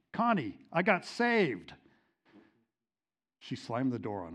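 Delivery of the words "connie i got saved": high, loud and slow, bursting with the news; excited announcement. Retold or imitated. Husband bursts in telling his wife Connie, overjoyed.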